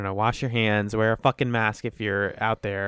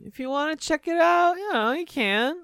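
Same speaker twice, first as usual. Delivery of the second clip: high teasing imitation of a podcast plug; mock plug voice. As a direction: parody the plug sweetly. Mimicking the other host's plug.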